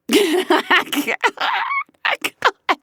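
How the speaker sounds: a long helpless high giggle; real laugh she can't hold back; high burst of laughter. Real, not acted. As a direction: break up laughing at the absurdity. Laughing retelling absurd story.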